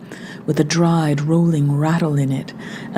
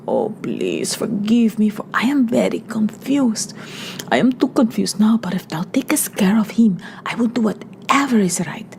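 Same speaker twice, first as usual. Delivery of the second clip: put-on breathless, half-whispered frightened girl praying; frightened prayer; 3 semitones higher than usual. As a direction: pray in a rush of fear, half under the breath. Maria prays in fear.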